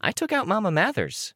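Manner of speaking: very high-pitched boast; high child character voice. A narrator's reading. Looksee backing up her boast to Tattletale, very high-pitched. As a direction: pipe it up far above your own voice.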